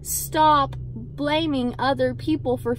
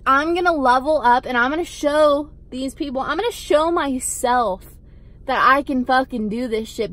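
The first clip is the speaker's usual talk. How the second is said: usual speaking voice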